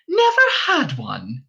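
put-on a touch of surprise in the old-woman voice; can't believe what she hears; astonished innkeeper's wife. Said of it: "looked astonished".

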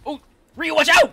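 high, loud warning call; calling from far away. Real, not acted. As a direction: shout the warning across the field. Warning a teammate from afar.

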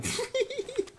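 soft, very high giggle. Real, not acted. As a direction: giggle softly to yourself. Laughing after showing off weapons.

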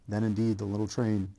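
ordinary delivery

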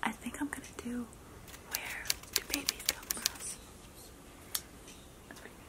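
quick, secretive whisper. Real, not acted. Player tells viewers the next phrase behind partner's music, whispering. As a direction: confide the chosen phrase under your breath.

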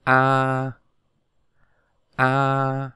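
long, level A with the mouth wide open. Shown as a demonstration. Teacher models the vowel A for learners, open-mouthed and drawn out. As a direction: hold A out steadily, mouth opened wide. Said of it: "Chúng ta bắt đầu đọc nào".

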